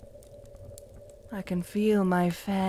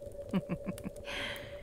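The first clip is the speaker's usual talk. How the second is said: breathy laugh; 2 semitones lower, 13 dB quieter than usual. Acted.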